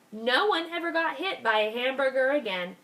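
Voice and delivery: usual speaking voice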